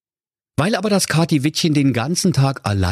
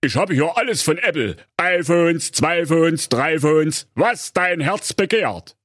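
disguised peddler voice; 7 semitones higher than usual. Acted. Disguised stepmother sells phones.

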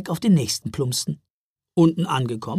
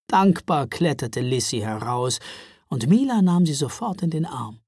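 ordinary delivery, nothing marked

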